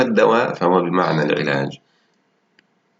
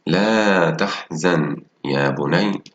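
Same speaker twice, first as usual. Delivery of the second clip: slow comforting line read aloud; much slower than usual. Shown as a demonstration. Teacher reads the comforting words to pupils, slow. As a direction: say it slowly, like soothing a child. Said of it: "ألاحظ الجمل وأقرأها".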